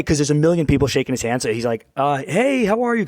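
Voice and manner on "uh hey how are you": higher-pitched, feeling-it-out hello; imitated uncertain greeting. Imitating his friend greeting a possible stranger, unsure. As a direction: greet someone you may not know. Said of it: "he's trying to see if, like, do I know this guy".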